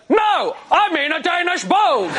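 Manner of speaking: high, drawn-out mock shout of refusal; stubborn insistence. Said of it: "here's how my dad chose to handle it".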